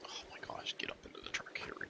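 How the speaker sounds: breathy whisper under the breath. Real, not acted. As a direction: whisper it to yourself. Whispered reaction while playing.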